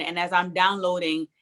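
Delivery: usual speaking voice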